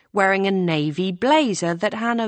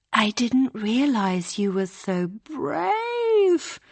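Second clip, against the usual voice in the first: slow, teasing, higher mock; 6 semitones higher, slower than usual. A narrator's reading. April teasing Hannah in her ear, mocking and slow. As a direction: tease her sweetly. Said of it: "said a mocking voice".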